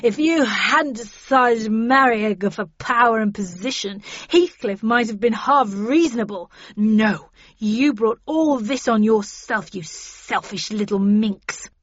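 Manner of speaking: loud, accusing man's character voice; heated, loud denunciation; angry yelling. A narrator's reading. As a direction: lay the blame with force, dragging out the insults. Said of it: "yelled Hindley".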